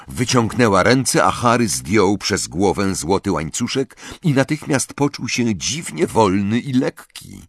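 ordinary delivery, nothing marked

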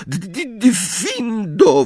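shaken stutter, then high drawn-out cry; stammered spell. A narrator's reading. Trembling girl cutting her friend's ropes, stammering. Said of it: "wyjąkała".

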